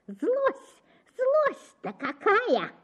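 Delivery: high, slow, spiteful chatter; aunt railing, pitched very high. A narrator's reading. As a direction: rattle on in spite. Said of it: "трещала тётка".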